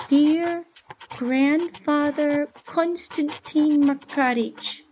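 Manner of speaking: put-on a lonely child writing home, voice lifted high and hopeful; young boy's letter voice. Orphan boy writing to his grandfather, high earnest child's voice.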